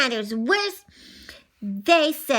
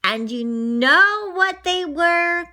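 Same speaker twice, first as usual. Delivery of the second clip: ordinary delivery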